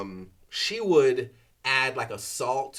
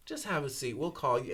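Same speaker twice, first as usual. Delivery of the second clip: softer, offhand staff voice; unbothered brush-off; 7 dB quieter than usual. Retold or imitated. Urgent care staff to a bleeding patient, offhand and dismissive. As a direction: imitate a receptionist who couldn't care less. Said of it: "it's not a big deal".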